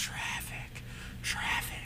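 breathy whispered word; whispering. Pretend. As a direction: whisper it almost inaudibly. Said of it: "he's whispering".

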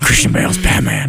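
hoarse, rasping superhero voice; harsh whispery vigilante; Batman. Acted. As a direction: rasp out the name. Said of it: "I could be".

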